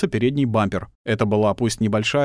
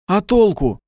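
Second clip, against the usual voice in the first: moaning; 10 semitones higher, 6 dB louder, slower than usual. Acted. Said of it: "простонал Шульжин".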